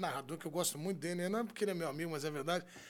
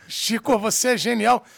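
excited praise; 3 semitones higher, 13 dB louder than usual. Real, not acted. Host hyping his guest.

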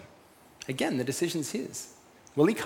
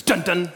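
punchy sung-out "dun, dun"; two loud, abrupt mock drum hits; imitated TV sting; 4 semitones higher, 5 dB louder than usual. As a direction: mimic a crime show's closing sting. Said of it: "And then it goes".

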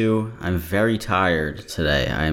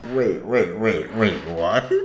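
stunned interruption; slower than usual. Real, not acted. Reacting to the survey claim.

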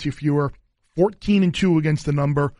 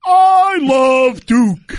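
loud, high, drawn-out sportscaster shout; Dick Vitale; 9 semitones higher, 8 dB louder, much slower than usual. Shown as a demonstration. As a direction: bellow it slowly like an over-the-top broadcaster. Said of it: "give you my best Dick Vitale voice".

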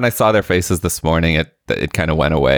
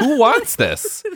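much higher, slow squeaky disbelief; mock outrage; slower than usual. Parent mocking kids' sports schedules, high slow squeal.

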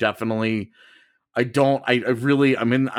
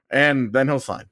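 ordinary delivery

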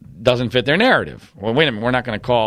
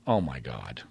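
low, hushed aside, much softer; he mutters it to himself, floored; stunned under breath; 13 semitones lower, 12 dB quieter than usual. Retold or imitated. Retelling his reaction to Al Lindsay, hushed and stunned. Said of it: "And I'm like".

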